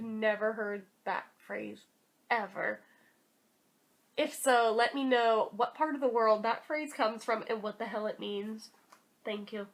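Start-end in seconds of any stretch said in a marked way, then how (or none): none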